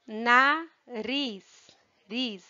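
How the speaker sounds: "Nariz" broken into na and RIS, the end drawn out; stressed syllable. Shown as a demonstration. Teacher showing pupils the force of a syllable, word split apart. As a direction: separate na from riz, holding the riz. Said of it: "percebem que a força".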